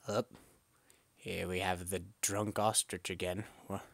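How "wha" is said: normal talking voice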